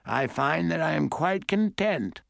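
put-on high-pitched old-lady voice; composed great-aunt. A narrator's reading. Great-aunt tells her niece she wants no cookies, composed. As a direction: decline in a high, elderly character voice.

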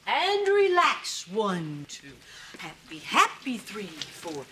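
slow, drawn-out counting with swooping pitch; strained relaxation mantra. Acted. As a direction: force yourself calm, word by word.

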